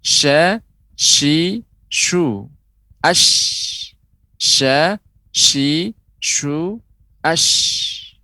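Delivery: SHA, SHI, SHU, ASH high and separate, long hiss ending ASH; letter shin demo. Shown as a demonstration. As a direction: sound out sha, shi, shu, ash with a thin, open vowel. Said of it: "yang kedua huruf shin". (sha, shi, shu, ash dengan nada tinggi)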